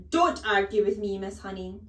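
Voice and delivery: loud, explosive rebuke; thundering. A narrator's reading. Headmistress silencing the teacher, thundering. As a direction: thunder it, then drop on the name. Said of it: "the headmistress thundered".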